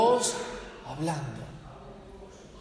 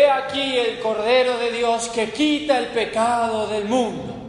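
projected to fill a huge empty hall; loud ringing declaration to empty pews; booming test proclamation; 5 semitones higher, 6 dB louder than usual. Retold or imitated. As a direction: boom it out to test the acoustics. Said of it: "para probar cómo se iba a oír su voz".

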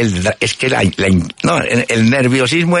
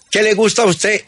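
mock sergeant; 11 semitones higher than usual.

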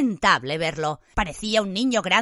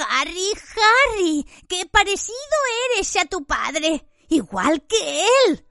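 high, slow, wheedling plea; whining Pettigrew voice; 10 semitones higher, slower than usual. A narrator's reading. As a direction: fawn over him in a thin high voice. Pettigrew pleads with Harry.

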